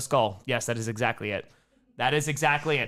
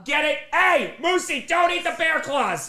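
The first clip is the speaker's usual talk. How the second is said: calling out; 12 semitones higher than usual. Real, not acted. Yelling at the dog.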